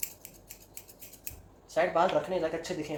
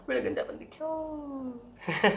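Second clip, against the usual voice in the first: gasp, then a slow high wail over the bald patch; real dismay at the mirror; horrified reveal of the bald spot; shocked gasp; 12 semitones higher, much slower than usual. Real, not acted. Gasps seeing bald patch.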